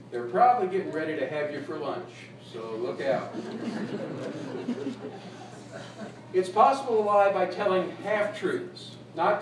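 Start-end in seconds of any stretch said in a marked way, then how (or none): 3.4-6.1 breathy chuckle; light amused laugh; soft laugh. Real, not acted. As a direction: laugh quietly at the joke. Laughing at his own joke.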